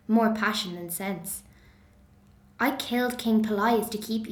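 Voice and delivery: bitter Medea. Acted. Medea confronting her faithless husband Jason, bitter and accusing. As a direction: fling every grievance at him, scornful.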